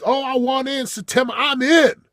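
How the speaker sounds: high, loud excited gushing; eager client mimic. Coach mocking eager sign-ups, high loud gush. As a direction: play the overeager client signing on.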